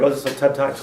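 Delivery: ordinary talk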